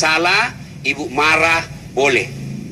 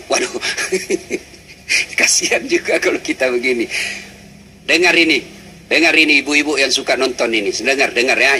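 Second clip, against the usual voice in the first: ordinary talk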